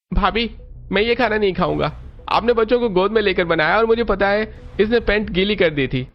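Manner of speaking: put-on high boy's voice, refusing; a fussy boy refuses his sister-in-law's food; young boy. Young brother-in-law refuses the food. (ऊँची आवाज़ में नखरे करता लड़का)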